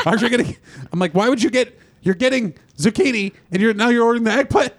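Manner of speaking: high-pitched exasperated retelling of an argument; upset protest. Retold or imitated. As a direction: act out being upset at someone's menu choice. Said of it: "I'm very upset".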